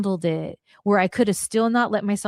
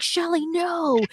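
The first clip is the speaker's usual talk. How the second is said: Punk holding her back; 9 semitones higher, slower than usual. Retold or imitated. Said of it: "He's like".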